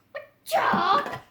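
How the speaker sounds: high, loud squealing shriek; hammed-up scream dissolving into laughter; mock scream. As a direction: shriek in fake panic. Character screaming at toilet.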